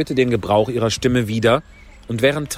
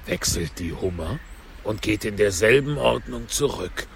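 Gryphon continuing; 4 dB quieter than usual. A narrator's reading. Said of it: "fuhr der Greif fort". (tiefe Greifenstimme)

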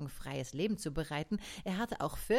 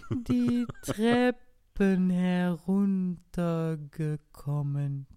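slow snail; 2 semitones higher, 6 dB louder, much slower than usual. Acted. Snail recalls the past, dragging.

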